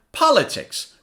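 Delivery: "Politics" in one go, POL high and stressed; quick "Politics", falling from a high first syllable. Shown as a demonstration. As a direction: say "Politics" as a whole word, punching POL. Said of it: "Now say it faster".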